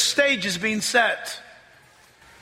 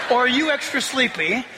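ordinary delivery

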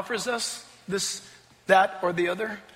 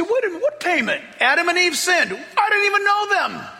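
high-pitched mock protest; indignant protest; 9 semitones higher than usual. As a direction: object in a put-on, disbelieving pitch. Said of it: "You say".

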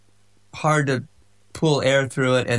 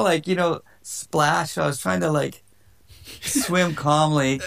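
usual speaking voice

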